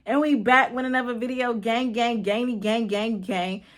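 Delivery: loud, bouncy YouTuber hype; hyped intro voice. Acted. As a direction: shout out the channel intro like a host. Hyped channel intro persona.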